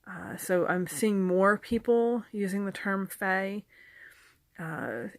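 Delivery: normal talking voice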